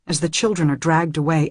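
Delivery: plain reading voice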